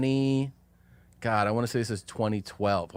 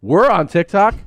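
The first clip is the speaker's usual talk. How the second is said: loud, high, thrilled question; retold excited outburst; 8 semitones higher, 10 dB louder than usual. Tipsy adults to kids filming TikTok, excited shout. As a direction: burst out as if amazed.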